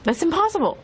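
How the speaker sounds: disbelieving. Retold or imitated. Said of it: "They go".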